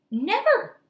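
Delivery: high, loud cry of never; emphatic cry. Acted. Boy answering the doctor, a loud high cry. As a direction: shout the word out in protest.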